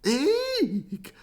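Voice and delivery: high startled eek; frightened shriek. A narrator's reading. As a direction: squeal in fright. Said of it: "shouted the elephant".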